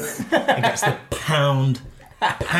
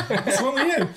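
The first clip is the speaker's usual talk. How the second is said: laughing; 9 semitones higher than usual. Retold or imitated.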